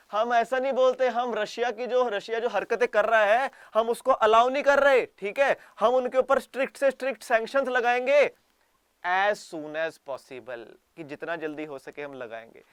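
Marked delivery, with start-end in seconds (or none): 0.1-10.6 loud, higher mock official promise; mock Japanese official. Japan mocked promising strict sanctions, loud and pompous. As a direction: ham up the tough promise. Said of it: "कहते".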